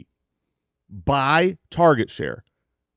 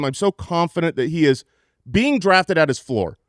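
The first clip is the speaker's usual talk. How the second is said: ordinary talk, nothing marked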